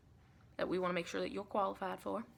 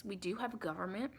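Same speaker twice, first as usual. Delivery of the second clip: ordinary delivery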